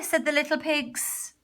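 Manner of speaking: ordinary delivery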